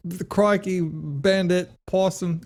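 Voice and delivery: high-pitched, hammed-up Australian; attempted Australian accent. Shown as a demonstration. Podcast co-host testing accents, attempted Australian accent. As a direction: have a clumsy go at an Australian accent. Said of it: "you could do Australian".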